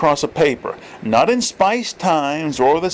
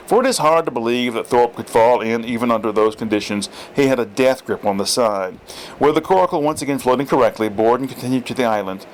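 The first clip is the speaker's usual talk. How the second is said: plain reading voice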